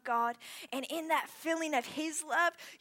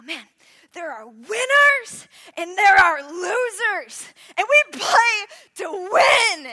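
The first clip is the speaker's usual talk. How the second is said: slow, much higher, much louder chant; shouted team mantra; 7 semitones higher, 16 dB louder, slower than usual. Retold or imitated. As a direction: roar the cabin mantra like a coach. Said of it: "we had this mantra".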